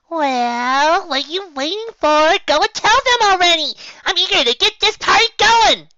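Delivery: high, loud, eager pony voice; bouncy excitement. Acted. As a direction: bounce with impatience. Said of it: "bouncing nonstop".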